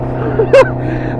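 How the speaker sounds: breathy squeak of a laugh; high laugh. Real, not acted. Laughing at the stalled car.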